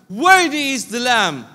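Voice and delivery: loud, slow "Worthy is the Lamb"; with strength. Shown as a demonstration. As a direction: pastor showing how strongly to say it, loud. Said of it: "naanay kusog".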